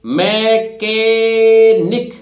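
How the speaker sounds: मैकेनिक very slowly, syllable by syllable, ch as k; silent-h pronunciation. Shown as a demonstration. Teacher having students read मैकेनिक, slow syllables. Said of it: "एच ना silent है".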